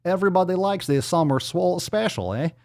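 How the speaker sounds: put-on muddled Canadian-meets-Kazakh comic accent; Canadian Borat. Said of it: "It was like a Canadian Borat".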